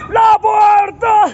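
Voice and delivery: long, loud, high shout; stretched-out shout after the closing door; drawn-out shout. Retold or imitated. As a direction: yell it out slow to stop the bus. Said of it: "y yo".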